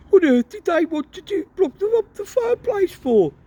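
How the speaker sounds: puzzled at the odd Muggles; baffled father. Acted. Father baffled by blocked fireplace.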